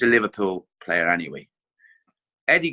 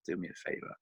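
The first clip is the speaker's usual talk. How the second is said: exasperated whisper; 5 semitones lower, 16 dB quieter, much faster than usual. Retold or imitated. Exasperated inner thought.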